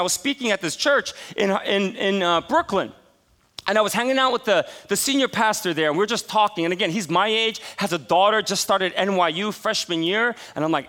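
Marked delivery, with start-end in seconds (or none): none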